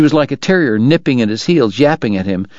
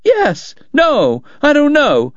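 high, flustered woman's demand; 11 semitones higher than usual. A narrator's reading. Kate to Doc after her fall, flustered and demanding. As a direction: pitch it way up and demand an answer. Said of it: "she demanded".

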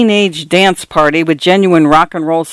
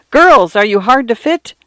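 ad pitch voice; 6 semitones higher than usual. A narrator's reading. Shop sign read as an ad.

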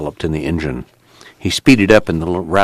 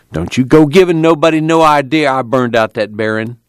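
higher-pitched, aggrieved grumble; grumbling; 6 semitones higher, 3 dB louder than usual. A narrator's reading. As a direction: grumble a warning in self-defence. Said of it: "Al grumbled".

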